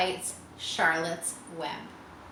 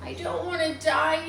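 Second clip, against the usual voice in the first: higher, mild moan; a tearful piglet; 8 semitones higher than usual. A narrator's reading. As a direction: moan it through tears. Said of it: "he moaned".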